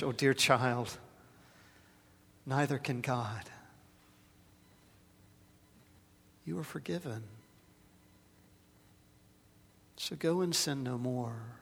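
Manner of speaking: softly reassuring, a hint of a laugh; gentle priest. Priest comforting the woman in the booth, gentle and soft. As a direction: soothe the nervous woman kindly. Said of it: "the priest laughed and said".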